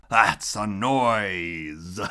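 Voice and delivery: slow, relishing Grinch voice; grinning Grinch. Acted. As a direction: savour each word with a grin. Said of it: "grinned the Grinch".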